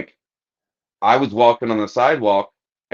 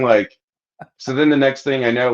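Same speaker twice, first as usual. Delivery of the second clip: usual speaking voice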